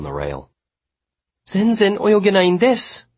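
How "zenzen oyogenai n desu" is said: high, loud desperate plea; frantic imploring cry in Japanese; raw, imploring confession. A narrator's reading. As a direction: plead desperately in a high woman's voice. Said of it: "imploring confession".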